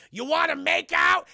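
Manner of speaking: sudden loud, high holler; hammed-up eager shout; comic blurted shout. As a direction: yell it out to break the tender mood. Blurts proposition after hushed scene.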